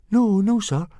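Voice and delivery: very high, hasty-to-placate voice; reassuring boy. A narrator's reading. Boy calming the furious instructor, reassuring. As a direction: placate an angry superior in a boy's voice. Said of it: "murmured Fishlegs reassuringly".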